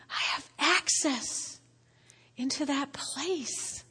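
soft, slow, moved near tears; quietly reliving a tearful moment; tearful awe. Retold or imitated. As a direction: savor each phrase in a hushed, overwhelmed voice. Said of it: "That's why I cried when we were singing".

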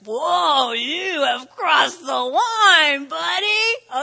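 loud, slow mock warning; preacher scolding the plotting leaders, mock shout. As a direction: call them out, drawn out and loud. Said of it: "I was like".

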